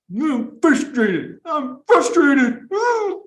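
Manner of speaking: high, slow, moaning mock complaint. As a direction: mimic a sulky, self-pitying underperformer. Mocking a whiny low performer.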